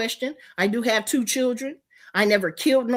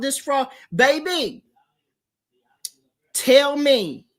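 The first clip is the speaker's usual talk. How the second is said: ordinary talk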